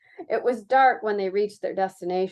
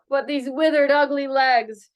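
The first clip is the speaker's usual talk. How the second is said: slow, louder anguished cry; the woman laments her legs, crying out slowly and louder; 5 semitones higher, 6 dB louder than usual. Acted. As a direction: she cries out over her withered legs in anguish. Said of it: "she cried out".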